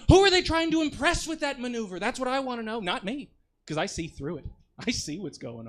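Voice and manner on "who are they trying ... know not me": mock indignant. Mocking smug bikers in raised voice.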